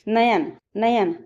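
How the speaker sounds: नयन said twice, slowly, syllable by syllable; teacher slowly sounding out नयन; slow syllable demo. Shown as a demonstration. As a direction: pull नयन apart slowly so the य glide is heard. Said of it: "उदाहरण है नयन".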